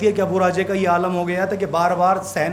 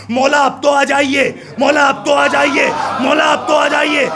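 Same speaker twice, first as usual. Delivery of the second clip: calling out to Maula; 4 semitones higher than usual. Acted. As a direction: cry out to the sky, pleading and building. Abu Raje calls out to Maula.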